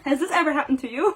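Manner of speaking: normal talking voice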